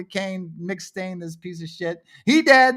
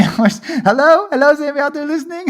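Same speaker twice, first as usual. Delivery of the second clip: fast, laughing call into the void; half-panicked chuckling hello; laughing plea; 6 semitones higher, 6 dB louder, faster than usual. Real, not acted. Calling to his viewers after sharing the wrong screen, laughing.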